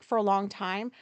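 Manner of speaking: ordinary talk, nothing marked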